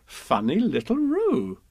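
high, slow indulgent lilt; fondly amused mother. A narrator's reading. Kanga humouring Piglet as Roo, indulgent lilt. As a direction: coo it slowly to a little one.

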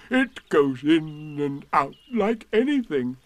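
put-on slow, pleased old donkey; Eeyore's voice. A narrator's reading. Eeyore delighted with his pot and balloon. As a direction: savour each word.

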